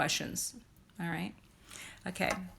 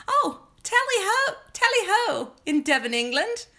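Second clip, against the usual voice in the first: high, loud hammed-up British accent, "Oh" swooping up; a cartoonish English "tally ho"; terrible British accent; 11 semitones higher, 6 dB louder than usual. To her viewers, a deliberately bad British accent. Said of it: "that was a terrible accent".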